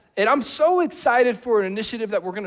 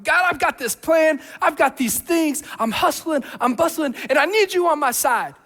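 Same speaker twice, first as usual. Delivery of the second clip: mock busy planner; 5 semitones higher than usual. Imitating busy believer to God.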